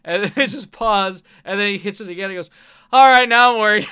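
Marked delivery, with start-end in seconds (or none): 2.9-3.9 suddenly much louder, mock worry; hammed-up alarm as Batman; concerned Batman. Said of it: "hearing a concerned Batman".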